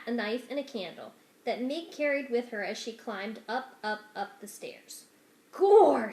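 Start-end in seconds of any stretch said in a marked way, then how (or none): 5.5-6.1 loud shouted call. Acted. Servant girl shouting to the rat in her pocket, loud. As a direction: bellow it into the dark. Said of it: "she shouted to the rat".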